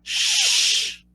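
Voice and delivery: unvoiced high shush. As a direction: hush them like a secret. Hushing the secret.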